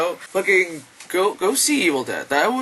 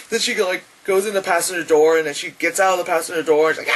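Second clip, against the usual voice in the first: ordinary talk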